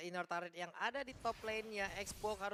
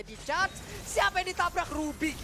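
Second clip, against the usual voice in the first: excited shout; 9 semitones higher, 9 dB louder than usual. Acted. Hype call during teamfight.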